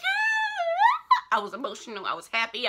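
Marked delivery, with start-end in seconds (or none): 0.0-1.4 much higher, louder, stretched yell; ecstatic scream. Retold or imitated. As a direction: scream with pure joy. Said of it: "And I was like".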